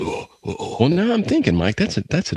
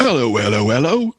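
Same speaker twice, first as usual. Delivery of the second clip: British bobby; 4 semitones higher than usual. Pretend.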